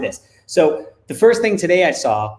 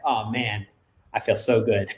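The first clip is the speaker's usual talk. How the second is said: quiet satisfaction; 6 semitones lower, 7 dB quieter than usual. Retold or imitated. Proud dad praising himself after advising his daughter, quietly pleased. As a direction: lower and softer, a private pleased sigh of words.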